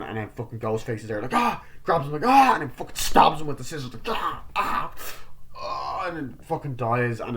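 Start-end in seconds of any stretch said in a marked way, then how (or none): 1.3-1.6 short, high, loud yelp; acted fright shriek; mock startled scream. Acting victim startled by Ghostface. 2.3-2.5 loud acted yelp; short hammed-up fright shriek; mock scream. Acting victim being grabbed. 4.1-6.1 hammed-up dying cries; mock death cries. Acting victim being stabbed.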